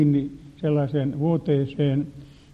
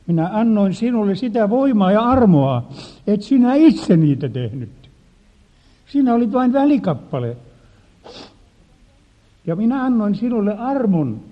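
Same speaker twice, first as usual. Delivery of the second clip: louder, higher gentle rebuke from Jesus; 5 semitones higher, 4 dB louder than usual. Retold or imitated. Jesus reminding the pastor of grace, louder and earnest. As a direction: remind him he was only a tool. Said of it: "Jeesus muistutti minua".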